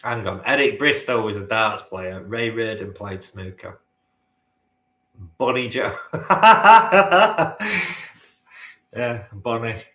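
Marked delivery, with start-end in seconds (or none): 6.0-8.1 high, loud belly laugh; loud burst of laughter. Real, not acted. Bursts out laughing at own answer.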